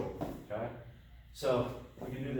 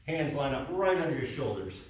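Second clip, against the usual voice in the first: ordinary talk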